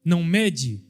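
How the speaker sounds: fervent pulpit delivery, pitch lifted; preaching at full force. Acted. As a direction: preach it out high and strong to the church. Preaching to the congregation.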